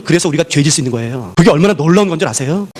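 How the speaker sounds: ordinary talk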